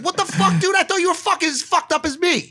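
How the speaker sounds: high-pitched incredulous protest; mock outrage. Stunned boyfriend protesting his girlfriend's turn to marriage, high mock outrage. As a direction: squeal it in baffled disbelief.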